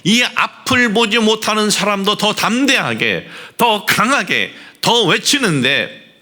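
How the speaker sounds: high-pitched, driving sermon delivery; fervent preaching. Acted. Preacher urging believers to press on boldly, fervent preaching.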